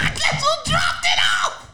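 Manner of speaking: high, slow, shouted punchline; cracking up while yelling the twist; excited laughing shout. Real, not acted. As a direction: bellow the reveal through laughter.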